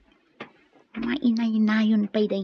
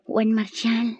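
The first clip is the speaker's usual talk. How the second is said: whisper; 4 semitones lower, slower than usual. Acted. Lani answering Marshall intimately.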